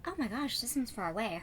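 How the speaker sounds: ordinary talk, nothing marked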